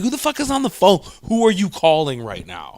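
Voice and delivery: high, loud angry parent. Retold or imitated. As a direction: yell it at the kid on the line. Parent yelling in story.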